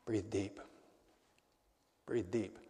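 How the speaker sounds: low, soft exhortation to breathe; hushed, calming. Real, not acted. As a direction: settle yourself quietly, low in pitch. Calming pause after hard words.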